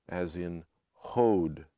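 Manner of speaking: hoed gliding from o to u, slow; slow oh glide demo; oh diphthong. Shown as a demonstration. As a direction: round the lips as hoed glides. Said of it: "pronounced as a diphthong as well".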